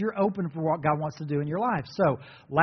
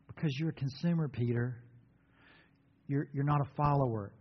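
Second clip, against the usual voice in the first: gentle rebuke; 4 dB quieter than usual. Shown as a demonstration. Jesus gently correcting Peter, softer. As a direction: correct him tenderly, not harshly. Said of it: "And this is how he said it".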